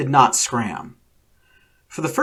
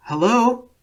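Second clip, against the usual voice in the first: high call into an empty room; 9 semitones higher than usual. A narrator's reading. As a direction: call out, unsure who is there. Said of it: "Akira called out into the room".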